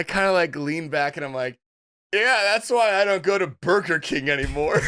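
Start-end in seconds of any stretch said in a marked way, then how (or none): 2.1-4.8 higher-pitched jokey quip; breezy joke mode, pitched up; joking. Retold or imitated. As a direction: toss it out as a misjudged punchline. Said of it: "was still in, like, joke mode".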